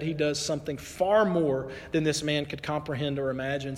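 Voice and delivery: normal talking voice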